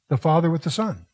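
normal talking voice

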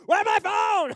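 hammed-up panic over a lost phone; frantic shout. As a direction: scream it while tearing the couch apart.

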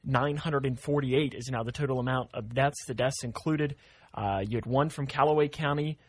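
steady radio news delivery; news read. Acted. Morning host reading covid numbers to listeners, news-read style.

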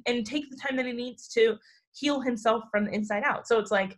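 ordinary talk, nothing marked

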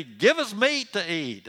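high, loud mock whine; whining. Moses mimics the people whining for meat, whining. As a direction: imitate whiners demanding food. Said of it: "They keep whining and saying to me".

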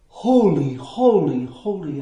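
breathless adoration. Shown as a demonstration. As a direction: stammer in awe until the voice dies down. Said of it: "breathless adoration that can only stammer".

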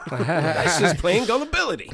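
slow, laughing punchline. Retold or imitated. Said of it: "Chris Rock does that whole thing".

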